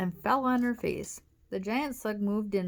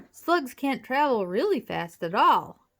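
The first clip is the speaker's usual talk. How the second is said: louder, higher call to a brother; 5 semitones higher, 7 dB louder than usual. A narrator's reading. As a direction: call it back while running. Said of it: "called out to her brother".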